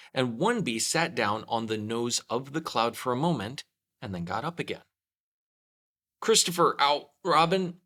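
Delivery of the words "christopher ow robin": high, loud call down from the sky. A narrator's reading. Pooh hanging from a balloon calling to Christopher Robin, loud call. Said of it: "called out the cloud".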